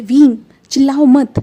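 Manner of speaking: loud, firm order; stern command. A narrator's reading. Wangji telling Wing to stop shouting, loud and firm. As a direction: cut him off sharply.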